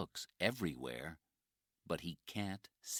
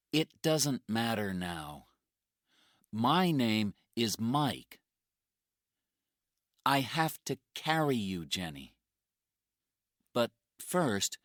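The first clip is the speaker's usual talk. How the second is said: determined rescuer; 6 semitones higher, 7 dB louder than usual. Acted. Mike speaks to Jenny.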